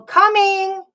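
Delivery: high, loud call down the stairs; a girl answering someone who called her name; retold yell. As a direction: shout it from three floors up. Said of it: "we hear her yell".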